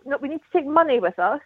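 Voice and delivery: usual speaking voice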